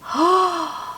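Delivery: sharp high surprised gasp. Acted. Reader gasping at the news for kids. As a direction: gasp in mock amazement.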